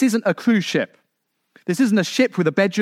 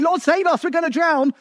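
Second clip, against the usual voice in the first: mock panicked scream; 11 semitones higher than usual. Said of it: "the disciples are screaming".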